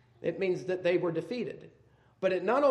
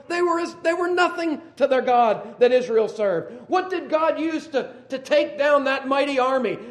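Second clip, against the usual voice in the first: loud, high preaching voice building to a question; fiery preaching; 9 semitones higher, 12 dB louder than usual. Acted. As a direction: preach it hard to the congregation. Preaching at full force.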